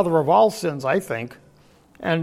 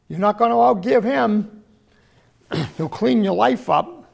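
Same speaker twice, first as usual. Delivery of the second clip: ordinary talk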